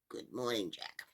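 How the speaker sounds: put-on low, soft old man's greeting; funny old man. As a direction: greet him low and quietly. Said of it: "funny-looking old man who said to him".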